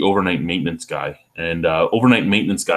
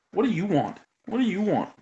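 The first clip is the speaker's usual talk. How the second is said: asking the dog in a high, soft pet voice; 13 semitones higher, 6 dB quieter than usual. Real, not acted. Talking to the dog.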